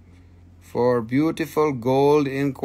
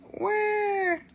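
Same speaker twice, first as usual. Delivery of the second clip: squeak; 5 dB quieter than usual. Real, not acted. Squeaky surprised cry.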